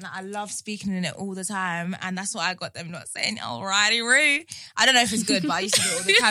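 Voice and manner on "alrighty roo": lightly Australian Alrighty Roo with a rising Roo; Australian accent. Shown as a demonstration. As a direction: show off the Australian catchphrase, Roo pushed up. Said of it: "I love an Australian accent".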